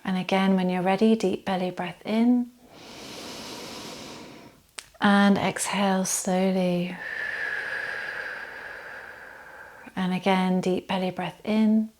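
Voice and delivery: calm meditation voice with long, unhurried pauses; settled, even yoga-teacher relaxation voice; guided body scan relaxation. Acted. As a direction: gently guide them into stillness, leaving space to breathe.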